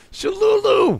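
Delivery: high excited call of the name. Real, not acted. Calling out to chatter.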